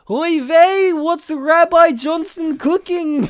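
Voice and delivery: high, loud, slow Yiddish wrestler; Yiddish-accented wrestling promo. Pretend. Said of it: "on the wrestling arena be like".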